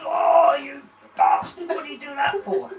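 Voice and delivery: loud, high, pained outcry; pained yell. Acted. Woman kicked in the crotch yells at Tarzan. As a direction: yell it out as the kick lands.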